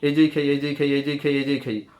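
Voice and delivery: এই যেই খেই fast in one breath. Shown as a demonstration. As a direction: chain এই যেই খেই without a pause. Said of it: "দ্রুত উচ্চারণ করব এক নিঃশ্বাসে". (এক নিঃশ্বাসে দ্রুত)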